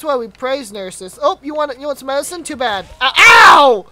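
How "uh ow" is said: loud, drawn-out high "ow"; pained shout. Real, not acted. Player hit after taunting the nurse, loud pained holler.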